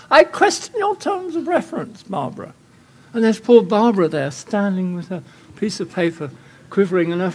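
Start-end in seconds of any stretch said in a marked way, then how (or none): 0.1-2.5 high, hammed-up pompous young English academic; pompous English faculty. Pompous young lecturer needling Barbara at a jury, in a high mock English voice. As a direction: look down your nose at the student's project. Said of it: "do the English version".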